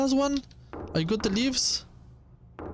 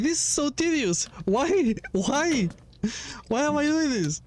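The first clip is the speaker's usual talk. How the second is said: high, whiny why, half laughing; exasperated whining; 6 semitones higher than usual. Real, not acted. Frustrated at tedious fetch quest.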